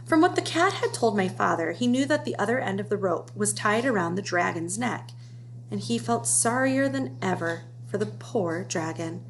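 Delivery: plain reading voice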